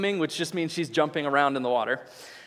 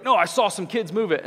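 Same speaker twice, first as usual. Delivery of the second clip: louder, higher mock yell; retold yelling; 4 semitones higher, 5 dB louder than usual. Boater yelling at the ranger, retold in a raised voice. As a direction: protest like an irate boater, imitated. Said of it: "yelling at the ranger".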